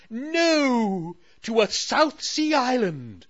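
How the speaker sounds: high, loud pompous queen voice; self-important huff. Acted. Queen correcting Sally, high and pompous. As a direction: puff up with royal importance. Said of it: "huffed importantly".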